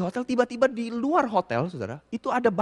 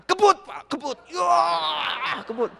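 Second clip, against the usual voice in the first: urging shout; 7 semitones higher, much slower than usual. Retold or imitated. Host urging him to pedal faster.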